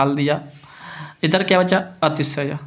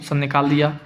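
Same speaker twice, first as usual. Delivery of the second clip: ordinary delivery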